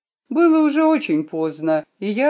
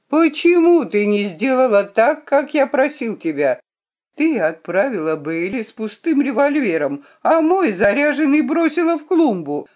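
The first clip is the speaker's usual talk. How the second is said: raised, higher-pitched scolding; indignant reproach; 6 semitones higher, 4 dB louder than usual. A narrator's reading. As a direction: demand an explanation, exasperated. Nephew scolds girl over the revolver.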